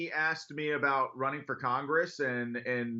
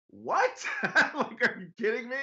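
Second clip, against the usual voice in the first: a much higher, laughing "What?" of disbelief; stunned disbelief; 8 semitones higher, 3 dB louder than usual. Retold or imitated. Retelling the host his mother's call, a laughing high disbelieving "What?". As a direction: bounce up in shock and laugh it off. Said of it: "And I was like".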